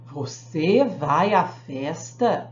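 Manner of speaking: low, drawn-out demonstration of amazement; astonishment. Shown as a demonstration. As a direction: stretch every word in slow, astonished disbelief. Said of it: "De espanto".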